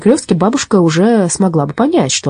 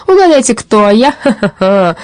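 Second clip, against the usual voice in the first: gloating robber; 5 semitones higher, 4 dB louder than usual. A narrator's reading.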